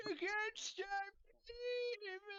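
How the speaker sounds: high straining villain cry; strained voice. Pretend. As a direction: strain and wail it out.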